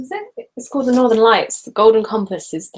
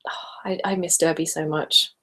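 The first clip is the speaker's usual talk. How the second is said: ordinary talk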